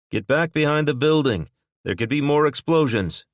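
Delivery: Will warning onlookers away from the burning car, loud call; urgent warning. A narrator's reading. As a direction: shout the crowd back from the flames. Will warns crowd near fire.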